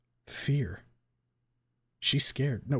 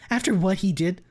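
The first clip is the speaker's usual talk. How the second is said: higher, louder indignant shout; outraged yell; 8 semitones higher, 7 dB louder than usual. A narrator's reading. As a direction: demand it of Reed angrily. Trish accusing.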